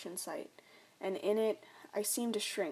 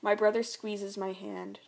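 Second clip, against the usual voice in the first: usual speaking voice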